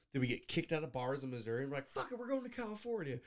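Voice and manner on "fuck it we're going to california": higher-pitched, devil-may-care declaration; mock defiance. Host imagining being kicked out of bars, mock defiant. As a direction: announce it like a gleeful reckless drifter. Acts out kicked-out drinkers' resolve.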